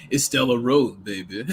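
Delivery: low, smooth mock crooner. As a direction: drop deep, fading softly at the end.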